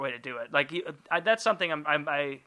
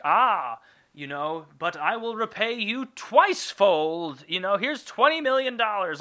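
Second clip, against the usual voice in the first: higher, slow airy celebrity voice with a hint of her put-on tone; lightly voiced rich heiress offering money; 5 semitones higher than usual. [name] offering money after the crash, higher voice. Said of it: "goes to me and is like".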